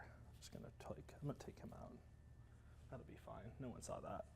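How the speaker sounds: hushed guilty aside; whispering. Real, not acted. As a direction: whisper like nobody's looking. Whispered confession.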